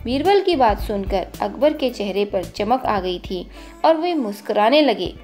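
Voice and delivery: ordinary delivery, nothing marked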